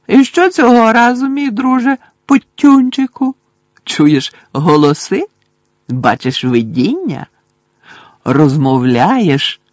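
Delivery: gleeful high poltergeist sneer; mocking poltergeist. A narrator's reading. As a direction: drag out every jibe to needle him. Poltergeist voice held in story.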